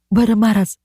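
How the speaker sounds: ordinary delivery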